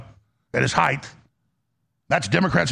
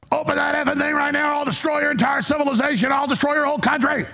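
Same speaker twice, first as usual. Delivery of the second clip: much higher, hammed-up ranting threat; mock Trump rant; 8 semitones higher than usual. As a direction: play the boss barking absurd threats. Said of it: "And he's like".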